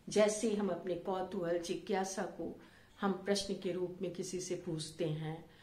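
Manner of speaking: ordinary delivery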